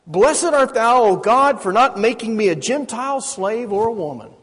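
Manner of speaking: intoned ancient blessing; recited prayer. Retold or imitated. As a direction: proclaim it like a rabbi at prayer. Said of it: "Here's what it was".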